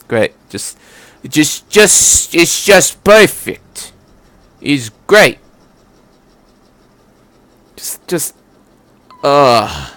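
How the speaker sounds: dry, drawn-out sarcasm, "perfect" said slowly; fed-up sarcastic sigh of a reader; sarcastic exasperation. Real, not acted. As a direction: roll your eyes at the story.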